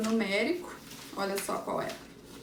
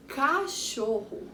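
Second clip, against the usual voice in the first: "cachorro" slowly drawn out; measured, lengthened "cachorro"; stretched-out syllables; 3 semitones higher, slower than usual. Shown as a demonstration. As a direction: linger on each syllable of "cachorro". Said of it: "Três sílabas".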